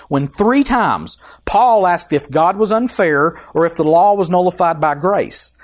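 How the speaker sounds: ordinary talk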